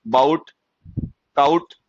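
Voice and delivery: बाउट and काउट with a mocking ow sound; mocking wrong pronunciation. Teacher teasing students with the wrong ow pronunciation. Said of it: "ब्राउट नहीं होता देहाती".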